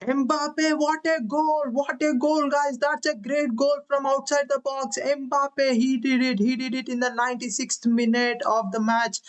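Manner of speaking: high, excited goal call; long goal yell. Acted. Late winning goal called live.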